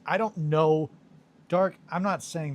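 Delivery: high, loud exclamation; loud protest. Real, not acted. Teased about living in the eighteen hundreds, loud protest. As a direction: burst out in protest, pitched well up.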